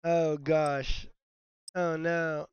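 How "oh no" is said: ordinary talk, nothing marked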